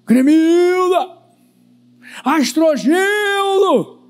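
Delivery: high, loud drawn-out call; acted searching shout; distant voice calling. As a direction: call out names across a forest, stretching each one. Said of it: "ouve-se uma voz".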